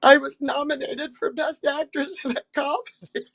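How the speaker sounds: high, pointed reply; indignant deadpan. Retold or imitated. Nominee telling a table guest she was nominated. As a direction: state it with wounded pride. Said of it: "And I said".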